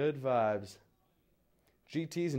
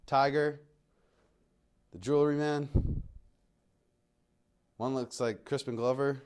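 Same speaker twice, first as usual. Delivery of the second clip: normal talking voice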